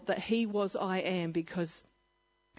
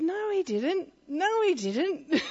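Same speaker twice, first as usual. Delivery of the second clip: high no, he didn't, slowing into laughter; a gleeful, giggling no, he didn't; laughing denial; 9 semitones higher, slower than usual. Retold or imitated. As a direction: laugh him down as you contradict. Laughing contradiction.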